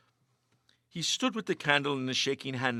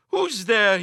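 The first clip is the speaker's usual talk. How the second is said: higher, much louder call; loud cry; 8 semitones higher, 11 dB louder than usual. A narrator's reading. Meagle calling into the creaking dark, loud. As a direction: challenge the dark stairs. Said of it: "he cried loudly".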